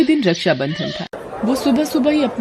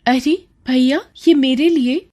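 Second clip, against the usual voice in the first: higher, delighted surprise; surprised delight; 6 semitones higher than usual. Acted. Seenu surprised by brother's gift, bright delighted question. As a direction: gasp happily at the gift. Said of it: "सीनू चौंक जाती है".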